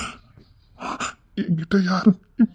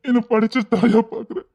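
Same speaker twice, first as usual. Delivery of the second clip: ordinary talk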